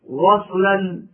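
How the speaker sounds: ordinary talk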